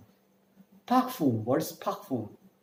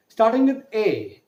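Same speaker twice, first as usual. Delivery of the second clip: ordinary talk, nothing marked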